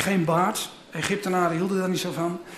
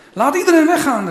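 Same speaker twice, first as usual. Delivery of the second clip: higher, louder command to clear the room; called out; 7 semitones higher, 6 dB louder than usual. Acted. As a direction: Joseph sending everyone out, calling loudly. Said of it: "riep hij".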